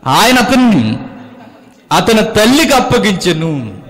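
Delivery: high, loud preaching of the verse; scripture proclaimed. Acted. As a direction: boom the verse out to the congregation. Preacher reads scripture at full force. (బిగ్గరగా వచనం ప్రకటించడం)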